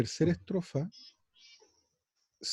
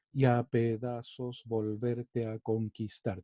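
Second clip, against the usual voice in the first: "y a pedazos volverte a conquistar" said slowly, beat by beat, a little lower; beats counted out; 3 semitones lower, slower than usual. Shown as a demonstration. Teacher counting ten beats in the verse. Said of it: "Tiene diez golpes".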